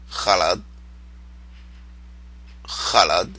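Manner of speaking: "Khalad" with a throaty kh at the start; correct khalad. Shown as a demonstration. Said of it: "khalad is right".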